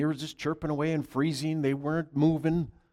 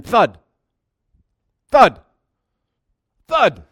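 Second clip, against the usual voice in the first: booming thud, thud, thud; acted sound of birds striking the window; loud thuds; 6 semitones higher, 14 dB louder, slower than usual. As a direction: slam each thud out like birds hitting glass.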